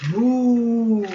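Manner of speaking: a long moo; cow moo. Acted. The cow mooing hello to the pig.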